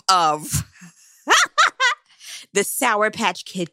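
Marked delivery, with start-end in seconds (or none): 1.3-1.9 high squealing laugh; high burst of laughter. Real, not acted. Mid-sentence about Karen, a high squealing laugh. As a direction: crack up loudly. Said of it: "I'm sorry".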